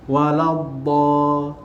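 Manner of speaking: "Waladda-" slowly, tongue to the side teeth. Shown as a demonstration. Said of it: "lidah ke gigi, sisi".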